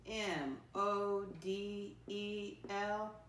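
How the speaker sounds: very slow, letter by letter; spelling aloud. Shown as a demonstration. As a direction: spell it out with long gaps for pupils. Spelling a word for pupils.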